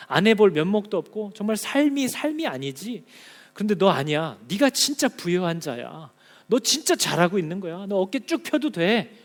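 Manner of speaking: stern then tender voice of God; God rebuking the church. Acted. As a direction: speak as God scolding, then comforting.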